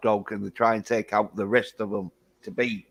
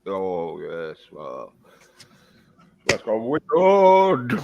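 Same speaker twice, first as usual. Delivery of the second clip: low wizard musing that swells loud and slow; Gandalf; 4 semitones lower, 3 dB louder, slower than usual. Acted. Showing a friend his wizard impression, low then booming. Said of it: "You sound like fucking Gandalf".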